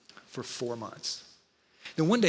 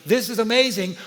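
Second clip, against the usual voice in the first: louder, higher burst of delight; excited inner voice; 4 semitones higher, 6 dB louder than usual. Retold or imitated. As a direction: blurt it out like a thrilled kid. Excited inner voice, over here.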